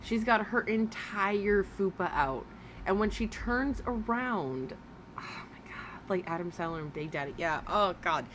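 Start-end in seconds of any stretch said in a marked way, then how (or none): 5.2-6.1 shocked whisper. Real, not acted. Shocked at the streamer's looks.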